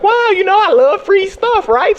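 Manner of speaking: mock excitement. Acted delight over free tickets.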